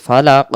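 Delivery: ফালাক ending in q from the tongue root, with an echo; deep released qaf. Shown as a demonstration. Teacher showing correct ending, ফালাক with echoed q. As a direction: bounce the final q of ফালাক. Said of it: "জিহ্বার গোড়া থেকে একটু শব্দ হবে".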